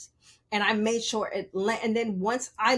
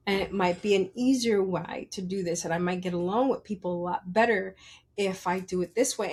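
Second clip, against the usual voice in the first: usual speaking voice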